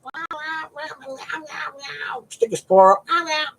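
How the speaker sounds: string of little high meows; cat meowing. Retold or imitated. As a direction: meow over and over like a chatty cat. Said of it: "the other cat, he'll be".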